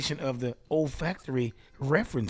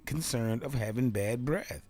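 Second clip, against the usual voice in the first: ordinary talk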